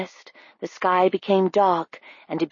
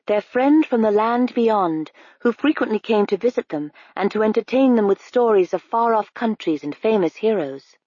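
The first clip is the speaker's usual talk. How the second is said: plain reading voice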